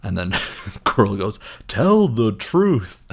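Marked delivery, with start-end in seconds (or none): 1.7-3.1 high, loud, very drawn-out order; theatrical villain roar; villainous demand. Retold or imitated. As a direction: demand it with hammed-up fury. Quirrell shouts at Harry.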